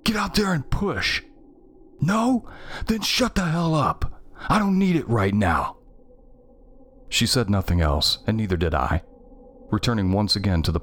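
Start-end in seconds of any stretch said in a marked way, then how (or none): 0.0-5.8 fed-up yelling in a sibling fight; angry yell. A narrator's reading. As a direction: let your sister have it, pitched up. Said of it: "I yelled".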